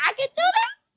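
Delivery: very high, loud tearful squeal; mock crying. Acting out someone crying through their mantra, high mock-tearful wail. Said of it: "And then you're crying".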